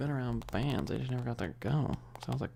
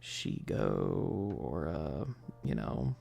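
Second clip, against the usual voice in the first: silly low voice; 8 semitones lower, much slower than usual. Pretend.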